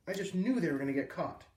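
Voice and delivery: plain reading voice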